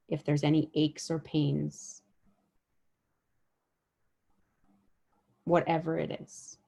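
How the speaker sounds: unhurried meditation guide, long pauses between phrases; guided body scan meditation. Real, not acted. Guide helping listeners notice their bodies with each breath, guided meditation. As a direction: lead them gently through the body, letting each phrase settle.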